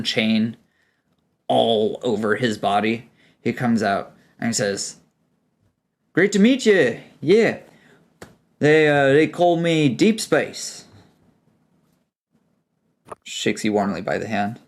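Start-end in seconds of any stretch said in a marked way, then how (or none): 6.1-10.9 cheerful Kiwi dwarf greeting; Kiwi accent. Acted. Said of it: "This is my attempt at a Kiwi accent".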